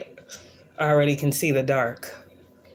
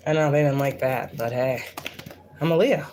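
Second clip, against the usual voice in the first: ordinary delivery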